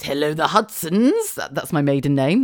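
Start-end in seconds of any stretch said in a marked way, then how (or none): 0.0-1.3 slow imitation of dad, rising at the end; dad's phone voice. Dad answering the family phone, slow rising phone voice. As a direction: draw out the greeting with an upward lilt. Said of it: "my dad goes".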